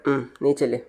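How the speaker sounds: usual speaking voice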